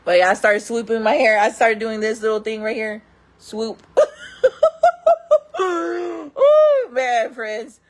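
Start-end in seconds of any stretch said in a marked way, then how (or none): 3.9-5.6 high, loud burst of laughter; cracking up at herself; big laugh. Real, not acted. Telling friends about her hair swoop, bursting out laughing.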